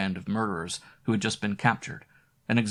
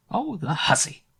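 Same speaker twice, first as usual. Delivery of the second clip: put-on higher, spiteful old woman; grumbling old woman; 9 semitones higher than usual. A narrator's reading. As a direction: grumble at the careless maid. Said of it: "I heard her mutter".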